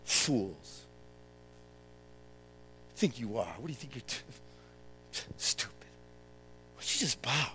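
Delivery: hissed, unvoiced scorn; scornful whisper. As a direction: friends sneering from the crowd. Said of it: "looking, going".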